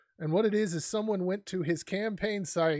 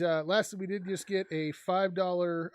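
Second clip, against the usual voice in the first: ordinary talk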